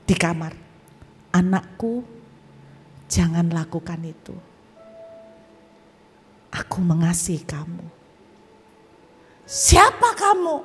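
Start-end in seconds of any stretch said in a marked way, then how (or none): 1.3-7.8 mysterious man's voice. Retold or imitated. Mysterious voice stops someone dying, low and tender. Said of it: "tiba-tiba ada suara cowok di kamar". 9.4-10.5 high, loud, stretched challenge; startled demand. Retold or imitated. Answering an unknown voice in the room, startled shout.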